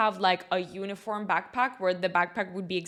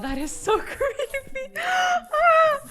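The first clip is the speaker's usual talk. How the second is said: creeped-out shriek; 6 dB louder, much slower than usual. Real, not acted. Reacting to creepy music from vents.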